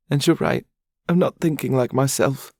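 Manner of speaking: high, sniffling voice, calming down; sniffly young woman; tearful young woman. Acted. Charlotte's tearful voice.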